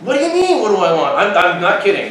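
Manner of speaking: high-pitched retold disbelief; exasperated comeback. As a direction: throw the question back, hammed-up. Acted exasperated comeback to girl.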